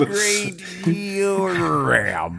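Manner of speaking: high, drawn-out cartoon coach voice. Co-host echoing the cartoon voice, exaggerated accent. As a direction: echo the warped accent slowly. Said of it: "turns into Coach Z".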